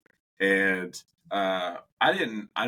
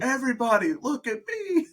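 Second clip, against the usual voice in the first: put-on high, excited little-kid shout; kid showing off. Toddler calling to the whole restaurant, high and thrilled. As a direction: squeal it like a toddler craving attention.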